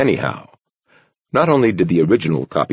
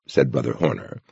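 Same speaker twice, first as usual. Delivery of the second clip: usual speaking voice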